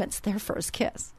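slow hushed whisper. Real, not acted. Mother telling listeners about daughter's kiss, whispered. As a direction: breathe it out in awe.

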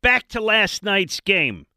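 put-on slow, booming old-school play-by-play; drawn-out ballpark radio call, loud and high; Harry Kalas. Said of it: "That's a bad Harry Kalas".

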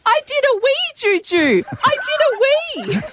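put-on very high, excited little-girl squeal; toddler voice. Aunt imitating her niece's excited toilet news, high squeal. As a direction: squeal it like a proud two-year-old. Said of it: "you hear in the open plan office".

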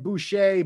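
"Boucher" said as boo-SHAY, silent r; French pronunciation. Shown as a demonstration. Host running through the options, "Boucher" as boo-SHAY.

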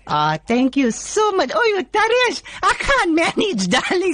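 man playing a doting Durban auntie; sweet-talking aunty character voice; Auntie Sheila voice. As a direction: male comic in a female auntie voice. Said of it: "you change into a female voice".

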